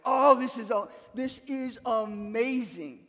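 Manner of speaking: loud "oh", then slow, drawn-out amazement; savoring delight. Acted. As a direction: act blown away by the first bite. Acting out delight at tasting food.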